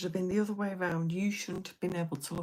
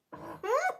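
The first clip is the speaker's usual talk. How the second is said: burst of laughter; 6 dB louder than usual. Real, not acted. Laughs at embarrassing story.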